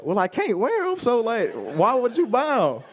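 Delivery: high, drawn-out upset complaint; upset retort. Retold or imitated. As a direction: snap back at mom's kind offer. Like, I was just upset.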